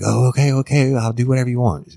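high-pitched panicked compliance, imitated; freaked out. Woman giving in to an armed attacker, imitated as freaked out. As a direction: act out frantic, frightened agreement. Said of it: "she was freaked out".

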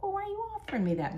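high, rapid objection; retold baffled retort. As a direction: question it in quick, high disbelief.

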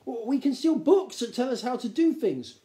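put-on flustered young gnome. A narrator's reading. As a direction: stumble into an earnest pitch.

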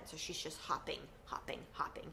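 soft hushed hopping; whispered. A narrator's reading. The narrator describing mom hopping, in a hushed whisper. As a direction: breathe the words out like tiptoeing.

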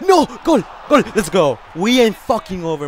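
loud, punched celebration; excited goal shout. Real, not acted. Gamer celebrating a comeback goal, excited shouting. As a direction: shout it out like the goal just went in.

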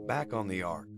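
ordinary delivery, nothing marked

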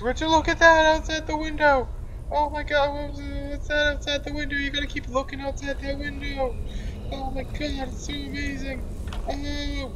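high sing-out, loud at first; hammed-up wonder, held high above normal voice; mock amazement. Pointing others to the window, mock amazement. Pretending to distract characters with window.

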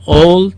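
"اولد" said as "ol" with the final d dropped; old without d. Shown as a demonstration. Teacher showing how the singer says old. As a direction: drop the d of "اولد", just "ol". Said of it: "دیشو تلفظ نمیکنه".

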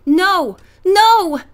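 二度目のnoを高く大きく上げる拒絶; objecting. A narrator's reading. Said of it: "Carol objected".

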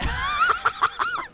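high shrieking burst of laughter. Real, not acted. Killed half his team.

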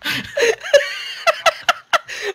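high, wheezing laugh; real high laughing fit; helpless laughter. Real, not acted. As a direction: laugh until you can't go on. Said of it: "I can't do this".

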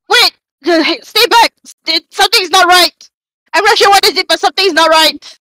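acted alarm, pushing out each phrase; urgent warning. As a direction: call out to keep a companion back. Player warns companion of danger.

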